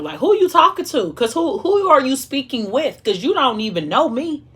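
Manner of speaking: high-pitched indignant confrontation; hot and bothered. Retold or imitated. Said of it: "I was hot and bothered".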